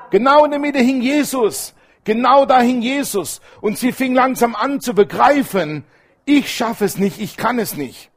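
high, forceful sermon delivery; impassioned preaching. Real, not acted. Preacher proclaiming where Jesus hung, impassioned and high. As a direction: drive it home to the congregation, pitched high.

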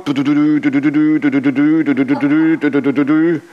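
chanted du du du like a Gameboy jingle; rhythmic mock video-game beeps; mock Gameboy tune. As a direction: imitate the game's beeping melody with du.

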